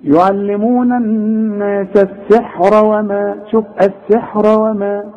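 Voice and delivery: السحر وما with a heavy ر carried into وما; heavy ra when joined. Shown as a demonstration. Teacher shows the heavy ر when joining السحر وما. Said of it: "إذا وصلت هي مفخمة".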